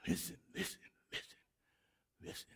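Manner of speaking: whispered listen, listen; hushed whisper. Real, not acted. Preacher hushing the congregation, whispering. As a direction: drop to a whisper to pull them in.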